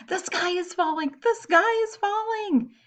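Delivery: high frantic chick cries; panicked screaming. Acted. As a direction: shriek it while running off in fright. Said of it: "Chicken Little screamed as he ran".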